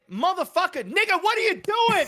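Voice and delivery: incredulous shout at the TV; yelling at screen. Retold or imitated. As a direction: yell at the screen in disbelief. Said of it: "it's like".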